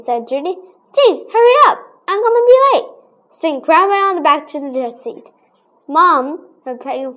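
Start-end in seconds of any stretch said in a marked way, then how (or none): 0.8-2.9 high, loud hurry call; impatient call. Retold or imitated. Judy calling her brother to hurry, high and loud. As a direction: hurry him up.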